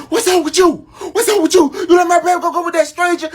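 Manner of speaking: very high, loud accusing shout; dad yelling at his child after the fight; imitating dad yelling. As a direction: yell at your kid for letting your baby go. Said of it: "My dad's yelling at me".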